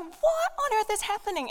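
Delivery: high panicked question; a frightened wife who can't believe it; alarmed cry. Acted. Wife staring at the dead horse, high panicked question. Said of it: "She's like".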